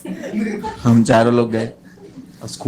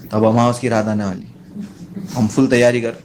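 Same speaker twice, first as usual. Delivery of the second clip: usual speaking voice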